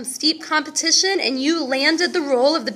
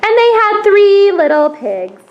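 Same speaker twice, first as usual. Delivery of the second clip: loud, higher storyteller voice; storytelling narration; 3 semitones higher, 6 dB louder than usual. A narrator's reading. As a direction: tell it big to the audience. Teacher narrates the play loudly.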